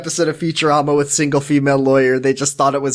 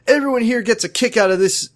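high-pitched retold teen distress; mock upset, pitched up; imitated upset; 7 semitones higher than usual. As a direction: play a tearful protest, half in earnest. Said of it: "like, crying and".

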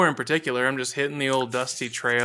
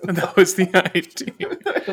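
laughing; 7 semitones higher, 4 dB louder, much slower than usual. Real, not acted. Laughing after offending friends.

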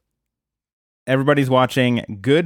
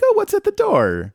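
very high, innocent wondering; curious falsetto. Retold or imitated. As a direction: squeak it out with fake innocence. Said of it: "they're like".

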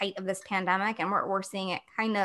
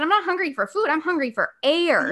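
higher, pressing explanation; exasperated insistence; 7 semitones higher, 4 dB louder than usual. Retold or imitated. Explaining air hunger to doctors, higher and exasperated. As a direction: make them finally get it. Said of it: "I said".

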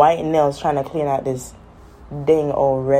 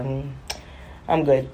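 ordinary talk, nothing marked

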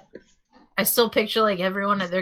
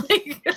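nervous laughter; 8 semitones higher than usual. Real, not acted. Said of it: "maybe I'm laughing 'cause I'm nervous".

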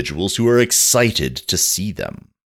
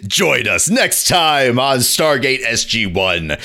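mock announcer; 5 semitones higher than usual. Podcaster closing a fake episode promo, mock announcer. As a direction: wrap up a TV teaser with announcer flourish.

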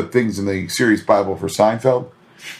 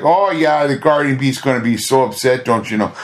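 mock Minnesota accent, lightly done; chirpy Midwestern "don't you know" voice. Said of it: "she's like".

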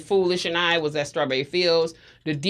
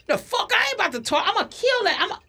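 retold furious threat. Acted quote, friend's furious threat.

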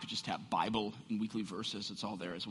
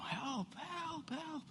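mock cry for help; 10 dB quieter, much slower than usual. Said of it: "he just starts screaming for help".

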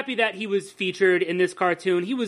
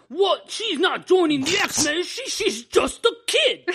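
high-pitched mock thick Australian accent; a cartoon Wolverine in broad Australian; 8 semitones higher, slower than usual. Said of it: "Wolverine opens his mouth for the first time".

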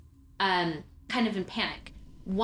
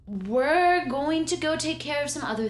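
much higher, slow, sugary dismissal; condescending high voice; 7 semitones higher, 6 dB louder, much slower than usual. Acted. Student brushes off Trinity, slow and sugary. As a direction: brush her off with fake sweetness.

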